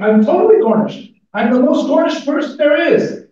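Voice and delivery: high loud mock-triumphant wail; hammed-up boast. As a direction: crow it like a proud winner of self-loathing. Character boasts of his nothingness.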